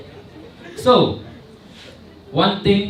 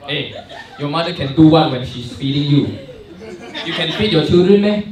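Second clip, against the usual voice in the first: usual speaking voice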